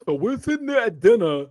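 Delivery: accented mock outrage; Biggie impression. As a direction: retell it as an indignant reality-show star. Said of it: "Yeah, I'm doing Biggie".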